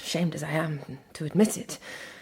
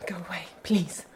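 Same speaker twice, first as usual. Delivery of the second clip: breathless whisper, begging him to go; hushed, frightened plea. Acted. Marian whispering to Fosco to go away.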